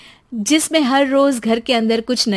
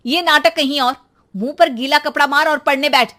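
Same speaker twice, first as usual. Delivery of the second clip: stern mother; 4 semitones higher, 8 dB louder than usual. Acted. Mother orders son to study.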